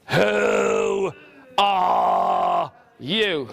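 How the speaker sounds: booming dragon demanding a name; big dragon looking down; a hint of a dragon. Dragon voiced in story.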